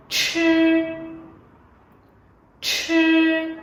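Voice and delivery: "Chư" with a strong puff of air, the tongue curled up; aspirated demo. Shown as a demonstration. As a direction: push the breath out hard on "Chư". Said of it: "Các bạn cùng đọc theo cô". (đọc mẫu "Chư" bật hơi mạnh)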